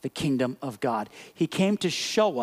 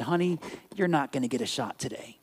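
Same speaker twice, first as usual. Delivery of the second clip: gentle doctor; 5 semitones lower, 5 dB quieter than usual. Retold or imitated. Doctor kneels, soothes boy.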